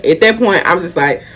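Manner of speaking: normal talking voice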